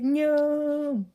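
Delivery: long, high, loud cartoon no; shouted refusal. Character refuses the one who wants to join, shouted refusal. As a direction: refuse with a big drawn-out mock shout.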